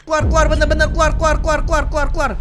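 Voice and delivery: loud, high, rapid repeated order; fast, high-pitched yelling on repeat; urgent shouting. Real, not acted. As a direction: urge a teammate out in a panic. Urgently orders the player to get out.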